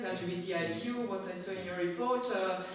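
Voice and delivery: usual speaking voice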